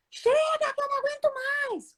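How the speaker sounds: high, exasperated mock cry of giving up; fed-up mother. Mother at her limit with a tantrum. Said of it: "Ou então".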